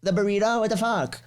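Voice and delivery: high-pitched imitation of an upset girlfriend; hammed-up, high indignant disbelief; mock outrage. Teasing host imitates guest's reaction to the cold burrito, mock outrage. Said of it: "you're there like".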